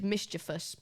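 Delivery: "mischievous" as MIS-chi-vus; three syllables in "mischievous", stressed on the first; correct pronunciation. Shown as a demonstration. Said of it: "You say it like this".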